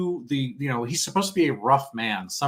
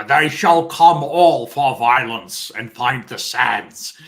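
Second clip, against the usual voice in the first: slow, louder, theatrical recitation; Stewart's Gurney; 7 dB louder, much slower than usual. Retold or imitated. A fan imitating the Bible-quoting warrior from the film, slow and grand. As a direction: intone it slowly like a Shakespearean knight.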